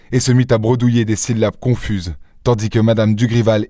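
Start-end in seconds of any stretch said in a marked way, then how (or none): none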